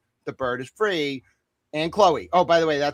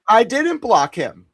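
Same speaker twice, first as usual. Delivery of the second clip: louder, higher and slow, each word weighted; indignant denial; 8 semitones higher, 6 dB louder, slower than usual. Real, not acted. Denying he blocked the OG, loud and slow. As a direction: protest your innocence firmly.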